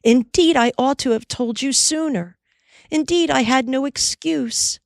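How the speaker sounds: Molly blaming herself, high and pleading; crying out. Acted. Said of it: "cried the girl".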